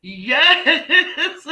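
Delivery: high, loud yes bursting into laughter; laughing yes. Real, not acted. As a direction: squeal it through a laugh. Cracking up at her memory.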